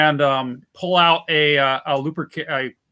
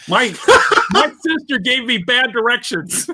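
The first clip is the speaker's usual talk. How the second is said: laughing; 8 semitones higher than usual. Real, not acted. Player's character makes an excuse to the siblings, laughing. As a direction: excuse spilling out through laughter.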